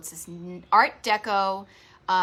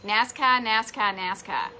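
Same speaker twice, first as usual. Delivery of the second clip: Boston accent, NASCAR with no R, "NASCAR" as nass-kah; wicked Boston "NASCAR"; slower than usual. Shown as a demonstration. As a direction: drop the R in "NASCAR", Boston style. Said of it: "That's how I pronounce it".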